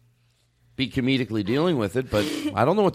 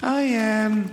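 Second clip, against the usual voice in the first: much higher put-on celebrity voice; Jay Leno; 7 semitones higher than usual. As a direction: squeak it out like the talk-show host. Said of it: "play with some voice things".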